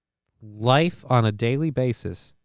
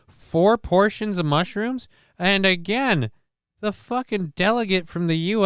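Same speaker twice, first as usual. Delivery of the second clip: incredulous; 7 semitones higher than usual. Real, not acted. Incredulous rant about mushroom doses.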